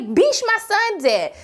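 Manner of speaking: high, drawn-out mock outrage; indignant retort. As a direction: exaggerate a slow, incredulous comeback. Retold blunt reply to chipper question.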